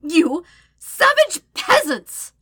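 high, loud, slow furious scream; enraged, drawn-out shout; fit of rage. A narrator's reading. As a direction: spit each word out at full volume. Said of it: "sets her off in a fit of rage".

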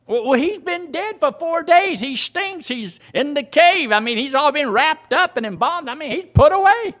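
high-pitched objection, insistent to the end; high incredulous protest. Retold or imitated. Preacher voicing mourners objecting at the tomb, high incredulous protest. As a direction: protest up high, pressing each point.

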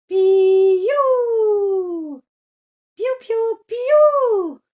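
very high, very loud chick cries; chick peeping. Acted. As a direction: peep like a newborn chick. Poussine piaille. (la poussine piaille très aigu)

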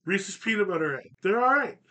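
ordinary delivery

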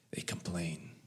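breathy whisper. Real, not acted. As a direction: drop to a hushed whisper for effect. Sermon point, dropped to a whisper.

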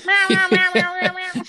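very high mock meowing like a cat; squeaky impression of a meowing cat; imitated cat. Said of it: "the cat's just going".

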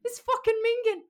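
high, loud Irish-accented repeat; Irish accent again. Shown as a demonstration. Asked to repeat the slang, high loud Irish accent. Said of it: "Say it again, say it again".

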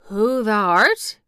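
put-on slow, wavering old man; lightly voiced shaken Yorkshireman; queer, shaky voice. A narrator's reading. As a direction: answer slowly as if stunned. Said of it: "answer in a queer, shaky voice".

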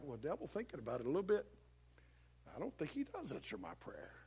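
low, soft admission; musing doubter. Acted. Believer confessing doubt to the devil, low and hesitant. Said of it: "And you're like".

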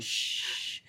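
hushed shh; shushing. Real, not acted. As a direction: shush them quietly. Hushing sibling before gossip.

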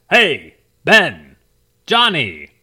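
loud, brassy cartoon hero voice; old cartoon announcer voice. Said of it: "a guy that talked just like this".

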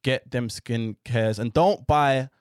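usual speaking voice

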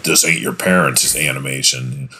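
movie trailer announcer voice; dramatic trailer voiceover, lightly done; big deep voice. Retold or imitated. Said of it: "big deep voice is like".

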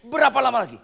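high, loud furious shout; great anger. Acted. Jesus shouting at the faithless crowd, furious loud outcry. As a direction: bellow it in anger. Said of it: "Itu marah besar".

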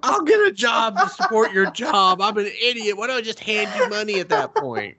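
high, laughing mock rant; mocking laughter. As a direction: ridicule him while cracking up. Mocking the suitor's logic.

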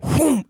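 whooshing blow-up noise; vocal whoosh of an explosion; explosion "whoom". Retold or imitated. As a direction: imitate something blowing up. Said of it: "it's just gonna go".

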